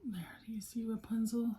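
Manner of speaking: hushed whisper to the viewers. Real, not acted. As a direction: whisper it like sharing a secret. Whispering to viewers about toy.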